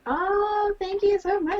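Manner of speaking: very high, sugary thank you; high sweet voice. Pretend. Voicing a grateful sender.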